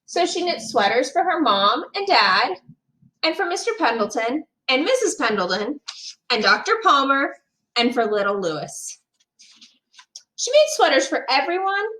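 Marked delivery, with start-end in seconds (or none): none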